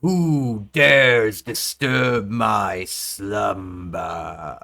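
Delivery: slow, booming ancient creature voice; ancient rasping undead king. Acted. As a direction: rumble it out like a woken beast. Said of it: "you hear in your head".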